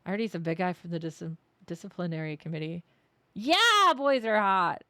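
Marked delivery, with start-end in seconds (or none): 3.3-4.8 high, loud yelled joke. Pretend. Streamer cheering on the teacher, loud playful yell. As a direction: blurt it out as a gag. Streamer jokes about the character.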